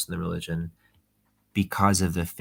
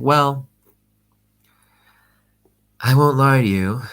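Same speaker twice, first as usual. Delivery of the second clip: louder, higher, emphatic "Well"; mock confession; 6 semitones higher, 10 dB louder than usual. Businessman making a hammed-up confession to his friend Johan, loud and high. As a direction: ham up the big solemn admission.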